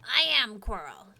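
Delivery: proud little kobold. Acted. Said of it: "I can't really do a different sounding kobold voice".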